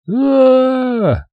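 shrill creature screech joining in; alien's high scream. A narrator's reading. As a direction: scream along in panic. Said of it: "подхватил Мэхштик".

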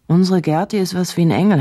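ordinary delivery

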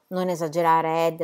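usual speaking voice

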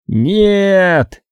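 high, ringing cry of NO; full-throated yell. A narrator's reading. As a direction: throw it out at the top of the lungs. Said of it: "во всё горло заорал он". (крик во всё горло)

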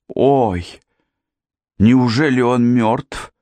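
lamenting wail. A narrator's reading. Janet fearing that the wounded man is dead, lamenting. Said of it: "запричитала Джанет".

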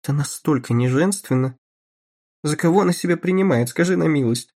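put-on a touch of a disdainful society girl; woman's voice. A narrator's reading. Narrator voices Betty Pope.

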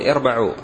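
"اربعوا" said irba'u, i first, a after the b; "اربعوا" with opening i and middle a; correct imperative. Shown as a demonstration. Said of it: "مثل ما تقول: اعلموا".

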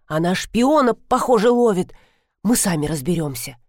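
ordinary delivery, nothing marked

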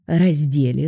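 usual speaking voice

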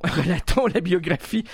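ordinary talk, nothing marked

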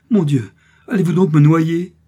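loud, high, horrified question; heart-rending cry. A narrator's reading. A woman realising she will be drowned, heart-rending cry. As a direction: wail it in terror. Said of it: "Ces cris avaient quelque chose de si déchirant".

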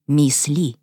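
ordinary delivery

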